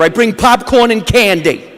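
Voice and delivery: normal talking voice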